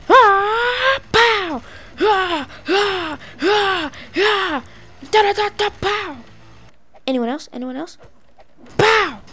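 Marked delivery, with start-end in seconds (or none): 0.1-6.2 high, drawn-out kung fu wail into a pow; play-fight yells, pitched way up; mock fight cries. Player punching at game enemies, mock battle cries. Imitating fight strikes while playing. 8.8-9.2 high, punchy "pow"; mock punch sound. Player landing a punch in game, mock hit cry.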